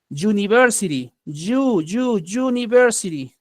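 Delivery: "University" begun like the Y in yo-yo; Y onset. Shown as a demonstration. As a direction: isolate "you", then say "university" with that same Y. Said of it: "Es como la Y de yo-yo". ("University" con la Y de yo-yo al inicio)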